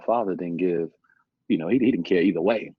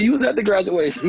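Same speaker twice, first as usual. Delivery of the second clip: exasperated; 14 semitones higher, 7 dB louder than usual. Retold or imitated. Exasperated retort to father.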